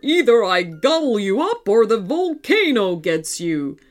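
loud, cross monster voice; snapping robot dinosaur. A narrator's reading. Robot sends children away from volcano, cross monster voice. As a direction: warn them off as a big metal beast. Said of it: "snapped the robot".

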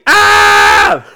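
loud horror-movie scream; big hammed-up shriek; mock scream. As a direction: scream at full force. Mimics mother's scream.

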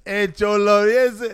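put-on high, loud try at a Mexican accent; rough comic Mexican accent; attempted Mexican accent. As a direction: call out in a mock Mexican accent. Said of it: "Give me a Mexican accent".

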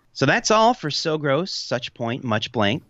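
upbeat host sign-off over music; podcast outro read. Acted. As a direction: read the credits like a radio host.

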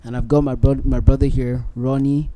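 ordinary talk

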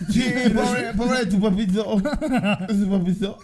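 talking through real laughter, pitch up; laughing. Real, not acted. Laughing at the poor dad's drunken vomiting. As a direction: laugh out each word.

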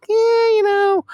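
high, loud, sing-song imitation; high mock voice. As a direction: toss off a squeaky dismissive quote. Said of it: "I'm like".

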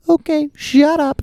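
put-on high, slow whiny kid. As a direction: whine it like an eight-year-old. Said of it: "eight-year-old me, I'm like".

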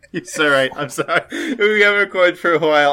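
laughing apology; giggling through the words. Real, not acted. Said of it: "You, you're right".

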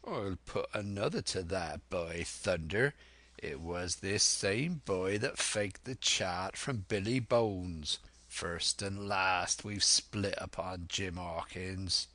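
slow sea-cook drawl; unhurried pirate voice; Silver, sly old pirate. Acted.

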